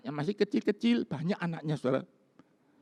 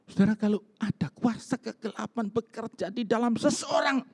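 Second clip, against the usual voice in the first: higher, urgent preaching; preaching warning; 8 semitones higher than usual. Real, not acted. Pastor warns his church about dark powers, in urgent raised preaching. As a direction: press the danger home to the listeners.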